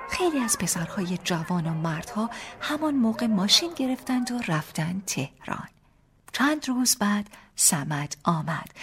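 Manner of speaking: plain reading voice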